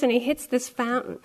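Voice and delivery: ordinary delivery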